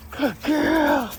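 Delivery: high, drawn-out cooing praise; dog praise. Real, not acted.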